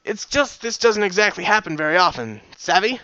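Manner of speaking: put-on pony with a hybrid British and Southern accent; odd mixed accent. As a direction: explain sheepishly in a jumbled accent. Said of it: "a combination of what seemed to be British".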